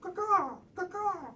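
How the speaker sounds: high, cawing bird call; raven caw. Retold or imitated. Imitates ravens.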